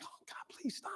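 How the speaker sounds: whispered begging under the breath; retold silent plea. Teacher being yelled at by parents, retold whispered plea. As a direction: whisper a helpless plea to yourself.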